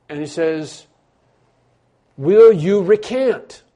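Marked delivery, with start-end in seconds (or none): 2.2-3.6 loud, slow, weighty "Will you recant"; stern demand. Retold or imitated. Emperor's court confronting Luther, loud stern demand. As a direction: boom it out like an imperial court.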